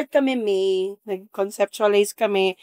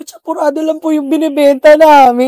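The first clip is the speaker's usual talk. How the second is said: calling out; 5 semitones higher, 11 dB louder than usual. Retold or imitated. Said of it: "Sabi ko".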